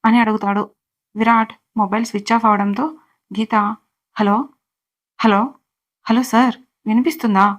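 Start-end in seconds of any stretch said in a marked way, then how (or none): 4.2-7.5 lightly raised calls into a dead line; half-hearted shout down the phone; mild phone shout. Acted. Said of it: "గట్టిగా అరుస్తుంది".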